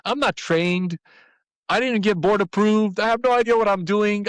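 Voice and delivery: blurting out alarm at the early call; panicked. Retold or imitated. Caught off guard by the school's call, panicking. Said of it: "I was like".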